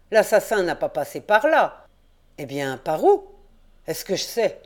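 insist, grumbling; stubborn old caretaker. A narrator's reading. Père Jacques argues. (le vieux gardien s'entête)

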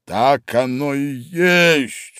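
slow, triumphant king; a king gloating over the tarts. A narrator's reading. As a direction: proclaim it like a winning point. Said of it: "с торжеством сказал король".

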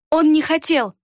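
high, insistent protest; stubborn insistence. A narrator's reading. Girl defending the boy to his attacker, stubborn. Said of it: "упрямо повторила Хейта".

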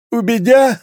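high, congested squeezed-out reply; meek cadet with a head cold; stuffed-nose voice. A narrator's reading. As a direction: squeeze it out through a blocked nose. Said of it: "выдавила толстушка".

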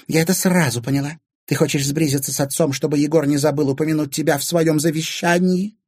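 high, pointed woman's accusation; Anfisa, accusing. A narrator's reading. Stepmother accusing Timofey over the will, hostile.

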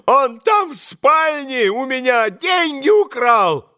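high shrieking accusation. A narrator's reading. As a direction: shriek like a robbed old man. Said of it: "завизжал Фёдор Павлович".

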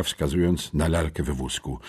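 ordinary delivery

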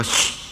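long breathy shush. Retold or imitated. Woman shushing a man in the cinema, drawn-out hush. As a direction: hush someone sharply with a long shh. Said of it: "una mujer me dijo".

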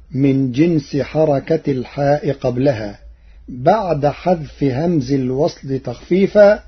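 usual speaking voice

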